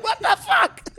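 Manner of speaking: high, shocked exclamation through a laugh. Retold or imitated. Recalling the moment he touched him, shocked and laughing. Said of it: "I'm just like".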